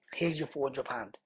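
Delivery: Here's your four hundred pound, whispered. Retold or imitated. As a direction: hand it over in a hushed, firm whisper. Retold handing over the money.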